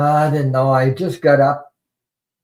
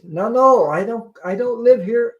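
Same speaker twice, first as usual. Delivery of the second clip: insistent; 9 semitones higher, 5 dB louder than usual. Retold or imitated. Voicing stranger insisting on giving tickets.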